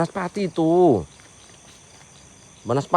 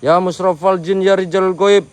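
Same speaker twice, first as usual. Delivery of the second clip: slow, raised calling to the spirits; summoning invocation; 7 semitones higher, 7 dB louder, slower than usual. Acted. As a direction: invoke the jinn out loud. Calls out to the spirits.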